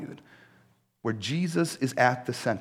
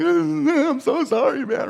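high, slow, cracking with tears, louder; tearful sorry; 6 dB louder, slower than usual. Retold or imitated. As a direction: let the voice break on the apology. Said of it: "I'm like".

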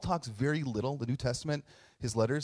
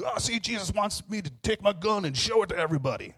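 high-pitched put-on zealot voice; mocking caricature; 7 semitones higher than usual. Mocking people who twist the swords verse, caricature voice. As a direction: parody an eager gun-toting believer. Mocking gun-loving believers.

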